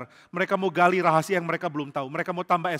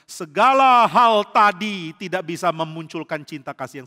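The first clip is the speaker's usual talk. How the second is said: preaching hard; 2 semitones higher, 9 dB louder, slower than usual. Real, not acted. Khotbah penuh tenaga.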